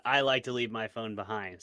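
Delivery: ordinary talk, nothing marked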